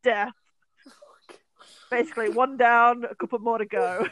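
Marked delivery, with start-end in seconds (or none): 0.0-0.3 gloating mock laugh. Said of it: "I'm like".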